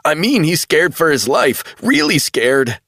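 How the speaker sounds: panicked, voice tight; frightened young man. A narrator's reading. Travell frightened.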